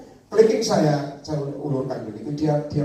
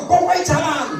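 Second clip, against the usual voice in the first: insistent command; 13 semitones higher, 7 dB louder than usual. Acted. God insists, acted.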